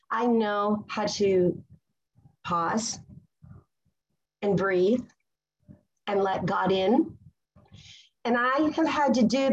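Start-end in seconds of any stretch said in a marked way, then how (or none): 0.1-7.2 calm and slow. Shown as a demonstration. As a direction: let each word settle, unhurried and calm. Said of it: "I know how to chill now".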